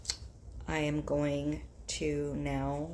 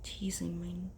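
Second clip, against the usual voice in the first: whispering; 10 dB quieter than usual. Real, not acted. Quietly teasing nose.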